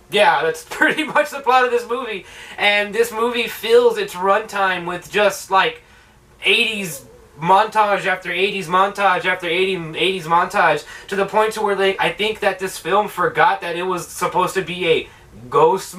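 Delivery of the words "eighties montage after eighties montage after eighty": usual speaking voice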